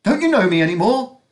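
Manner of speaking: high, loud squeaky woman's voice. Acted. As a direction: pitch it up into a squeak. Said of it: "she asked in a squeaky voice".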